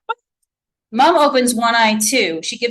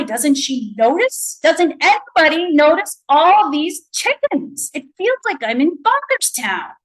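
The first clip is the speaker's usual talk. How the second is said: exasperated; 7 semitones higher than usual. A narrator's reading. Narrator exasperated inner thought.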